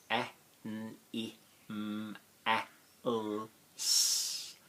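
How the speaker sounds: A N I M A L S said as letter sounds one by one; phonetic spelling. Shown as a demonstration. Teacher sounding out animals letter by letter for the class. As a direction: sound out each phonogram of animals. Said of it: "spell it phonetically".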